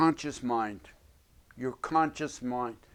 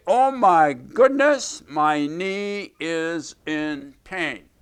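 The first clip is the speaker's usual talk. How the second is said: mock alarm; 3 semitones higher, 8 dB louder, slower than usual. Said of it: "the conscious mind is going to look ... and say".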